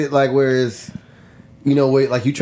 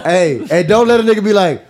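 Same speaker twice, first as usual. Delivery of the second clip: ordinary talk, nothing marked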